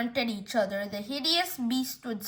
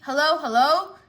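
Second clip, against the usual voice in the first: higher, rising, louder hellos; questioning phone hello; 3 semitones higher, 6 dB louder than usual. Acted. Caller to fire department, cut off, rising hellos. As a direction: check if anyone is still on the line.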